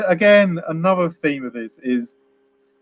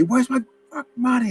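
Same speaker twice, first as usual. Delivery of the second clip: higher, mock-furious demand; mock angry dealer; 10 semitones higher, 4 dB quieter than usual. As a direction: ham up the thug losing his temper. Drug dealer loses it with Denny.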